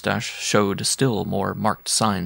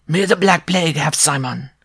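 higher, furious little dwarf cursing; angry curse; 7 semitones higher, 3 dB louder than usual. Acted. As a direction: spit the curse at his rival, higher. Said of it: "the dwarf cried".

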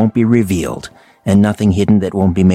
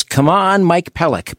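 imitated fan call; 7 semitones higher, slower than usual. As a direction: call it high like a rink-side fan, holding back the volume. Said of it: "for me, it was".

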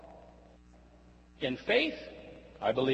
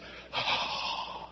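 long breathy whoosh of a dryer; hand dryer whoosh; 5 dB quieter than usual. Retold or imitated. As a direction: blow like an air dryer.

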